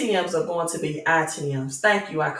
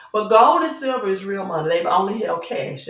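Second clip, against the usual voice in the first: ordinary delivery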